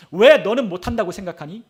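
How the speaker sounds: loud, imitated divine challenge; preacher's retold reproach, launched loudly; confronting question. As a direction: demand an answer, voicing the Lord. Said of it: "주님이 우리에게 따지고 물으십니다".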